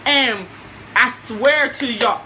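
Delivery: normal talking voice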